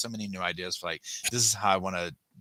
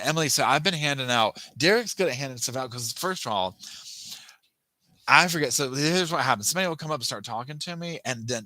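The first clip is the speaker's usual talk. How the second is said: ordinary talk, nothing marked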